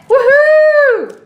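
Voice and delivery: high, loud woohoo of relief; triumphant cheer. Real, not acted. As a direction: celebrate getting it open. Finally opened the package.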